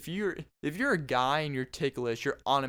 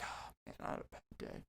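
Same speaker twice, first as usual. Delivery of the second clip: breathy whisper; grumbling bully; 16 dB quieter, faster than usual. Retold or imitated. Bully friend grumbles his bad day in a whisper. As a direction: mutter it under the breath. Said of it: "They'd be like".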